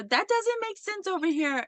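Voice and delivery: high-pitched protesting parent; mock heckling. Parent challenging the doctor on stage, mock heckling. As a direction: imitate an indignant parent objecting from the audience. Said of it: "these parents are pretty much heckling this guy".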